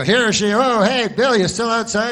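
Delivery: mock anchor checking in with Bill; news anchor. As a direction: hail the reporter live on air. Anchor calls to Bill again.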